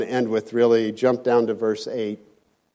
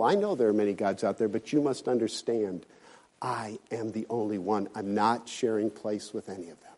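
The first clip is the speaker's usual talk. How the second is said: hushed; 4 semitones lower, 7 dB quieter than usual. Acted. Quieter voicing of God.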